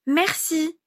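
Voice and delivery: put-on high little-boy voice; sweetly dutiful kid, high-pitched; polite child. A narrator's reading. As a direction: say it like a well-mannered small child. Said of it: "répondit-il poliment".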